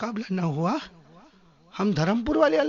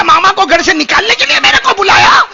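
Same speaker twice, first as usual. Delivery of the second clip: loud, higher, disbelieving yell; shocked outburst; 11 semitones higher, 14 dB louder than usual. Retold or imitated. Preacher retelling his shock at being called to expel a jinn, loud. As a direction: shout back in comic disbelief. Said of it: "میں نے کہا".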